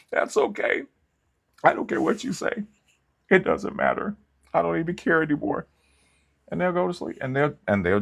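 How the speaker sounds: put-on high, whispery falsetto of a sulking girlfriend; mock girlfriend voice. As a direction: squeak it out like a hurt, fake-indifferent girlfriend. Mimicking the girlfriend's dismissive voice.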